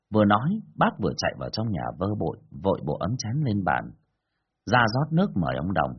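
ordinary delivery, nothing marked

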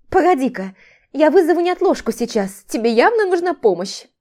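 high-pitched incredulous protest; flustered, exasperated outburst; indignant alarm. A narrator's reading. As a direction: talk to him as if he's crazy. Alarmed, mocking protest to him.

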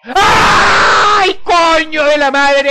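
high loud shriek, slow curse; terrified scream. Real, not acted. Gamer screams when the old woman appears.

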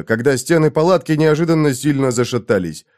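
ordinary delivery, nothing marked

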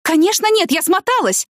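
высокий возмущённый выкрик; отрежь ответ на взводе, высоким голосом; взвинченный ответ. A narrator's reading. (взвинченно)

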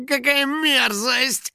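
high, slow groan of revulsion; disgusted. Acted. Finik about to land in garbage, disgusted. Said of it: "вздохнул домовой".

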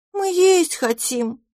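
писклявый голосок, гораздо выше, медленно; пищать как капризная малышка; пискляво. A narrator's reading. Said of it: "пропищала третья". (писк голодной русалочки)

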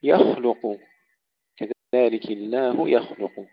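يخلق with a thick emphatic KH then light L; heavy kha. Shown as a demonstration. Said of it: "نفخم الخاء جيدًا ونرقق اللام بعدها". (تفخيم الخاء في يخلق)

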